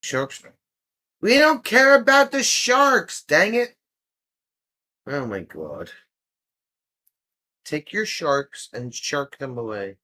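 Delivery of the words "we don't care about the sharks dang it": high, loud fed-up cry; exasperated outburst. Real, not acted. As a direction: blurt it out loud, fed up with the sharks.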